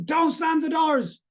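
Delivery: high, slow mock yell at the kids; retold scream. At the kids slamming doors, a high mock yell. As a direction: yell it out, half-replaying the old anger. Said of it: "I would just scream".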